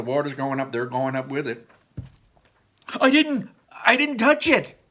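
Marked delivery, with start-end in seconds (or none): 3.0-4.8 high, loud panicked denial; Ian protesting. Acted. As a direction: squeal it out like a scared boy. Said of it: "said Ian".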